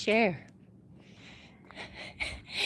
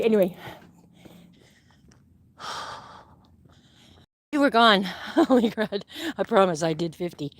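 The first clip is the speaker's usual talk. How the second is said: normal talking voice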